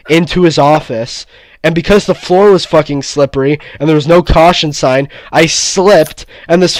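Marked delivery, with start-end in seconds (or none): none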